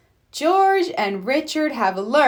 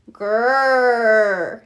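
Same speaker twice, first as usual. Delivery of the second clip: a little kid's pretend growl, louder; small mock roar; playful dinosaur growl; 4 dB louder than usual. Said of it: "he growls".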